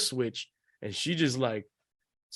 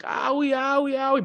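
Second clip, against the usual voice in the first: put-on high, whimpering owie in a child's voice; whiny kid complaint; 12 semitones higher than usual. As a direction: whine like a little boy with sore legs. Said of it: "really seriously compl".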